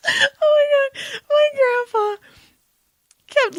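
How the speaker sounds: high, slow, squeaky laugh-crying; laughing fit. Real, not acted. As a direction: push the words out through tears of laughter. Laughing fit before telling grandpa story.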